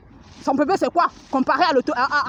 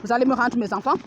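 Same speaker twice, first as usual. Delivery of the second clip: normal talking voice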